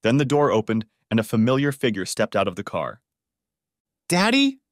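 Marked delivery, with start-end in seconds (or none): 4.1-4.6 high, loud startled cry; bewildered cry. A narrator's reading. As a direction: call out to him in disbelief. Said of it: "she cried out in bewilderment".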